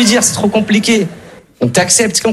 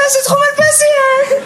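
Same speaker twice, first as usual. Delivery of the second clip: much higher, falsetto squeak; squeaky silly voice; slower than usual. Pretend. Customer leaving fitting room, falsetto squeak. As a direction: squeak it like a cartoon.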